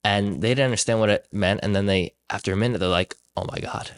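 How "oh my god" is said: breathless, soft oh my God; awed, hushed gasp of words; stunned whisper. Retold or imitated. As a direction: friends struck speechless by the photo. Friends realise the picture matches.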